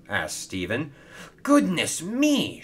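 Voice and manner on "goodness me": slow, high cry of alarm; high-pitched, startled exclamation; shocked shout. Acted. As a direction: cry out in drawn-out dismay. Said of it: "shouted Hero".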